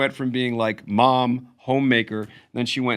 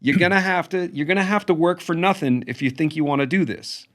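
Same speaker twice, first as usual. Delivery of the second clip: higher-pitched mock-mother warning; put-on high voice of a cautioning mother; imitating mother; 7 semitones higher than usual. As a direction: lecture as a comic mother, voice pitched up. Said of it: "she was just like".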